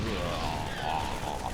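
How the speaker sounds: long, low groan of dismay; low pained groan. Real, not acted. Groaning at more dead probes.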